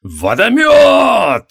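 high, loud, drawn-out spell shout; battle cry. A narrator's reading. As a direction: hurl the spell name like a war cry. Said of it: "вскричала Санте".

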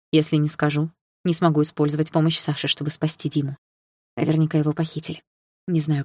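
ordinary delivery, nothing marked

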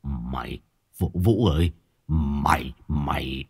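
he can barely get the words out; stammering. Acted. Shocked father stammering at his son. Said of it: "Ông Hai Sung bấy giờ lắp bắp".

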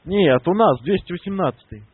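ordinary delivery

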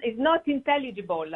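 ordinary delivery

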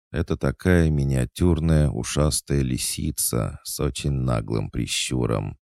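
usual speaking voice

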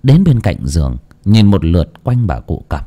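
ordinary delivery, nothing marked

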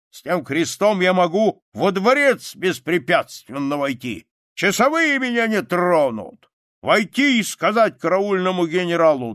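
put-on loud, high, declaiming old soldier; swaggering soldier's harangue; shouted boasting. A narrator's reading. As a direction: boast at full voice, demanding to be heard. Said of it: "закричал солдат и стукнул".

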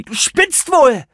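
very high, loud, drawn-out yell; furious high-pitched retort; hissing outrage. A narrator's reading. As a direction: spit it at him, incensed. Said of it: "fauchte Serenity".

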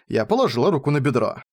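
ordinary delivery, nothing marked

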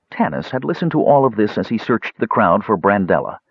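ordinary delivery

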